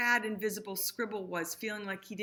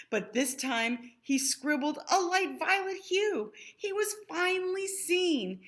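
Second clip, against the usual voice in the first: put-on lively read-aloud to children; sing-song rhyming storytelling; storybook reading; 4 semitones higher, 5 dB louder than usual. A narrator's reading. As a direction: read it like a bedtime picture book. Rhyming picture book read aloud.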